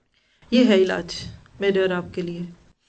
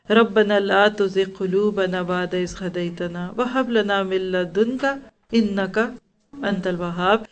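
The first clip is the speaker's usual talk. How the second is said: Quranic dua recitation; 2 semitones lower, 3 dB quieter than usual. Acted. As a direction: recite the dua quietly and devoutly. (धीमी, नरम दुआ की तिलावत)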